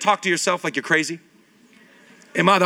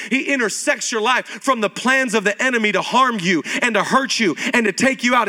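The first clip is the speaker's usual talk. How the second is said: ordinary delivery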